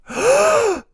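sharp high gasp of shock; a high theatrical gasp; mock gasp. Shocked reaction to voter fraud.

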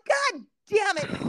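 slow, high frustrated groan of a curse; exasperated curse. Retold or imitated. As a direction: drag out the annoyance. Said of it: "So I'm like".

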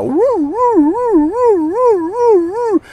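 high, slow siren wail; imitated police siren. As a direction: wail like a cop car pulling up.

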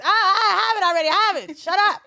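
high, loud scolding shout; yelling mom. Retold or imitated. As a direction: snap back at him, shouting. Mom yelling at dad in Target.

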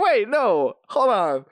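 high-pitched interruption of your own thought; acted sudden realization. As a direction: stop yourself short, voice jumping up. Acting out a sudden second thought.

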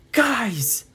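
sharp, high, loud call; yell. Acted. Brian rouses his drowsy friends with a yell. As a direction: yell it to jolt everyone awake. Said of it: "Like that, he yelled it".

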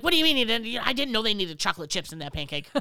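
high, loud mock scolding; mock yelling boss. Cook yelling at the girls, loud mock scolding. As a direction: scold the servers for fun. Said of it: "Be like".

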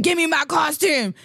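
mock shout. Fight over stolen costume.

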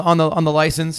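usual speaking voice

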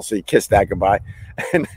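ordinary talk

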